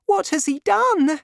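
put-on high, light grateful young woman. A narrator's reading. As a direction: lift the voice for the young heroine.

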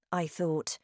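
ordinary delivery, nothing marked

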